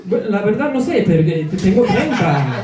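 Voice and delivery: bold Spanish alter ego back on stage; hammed-up Spanish showman voice; Nicolas persona. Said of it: "Back to Nicolas".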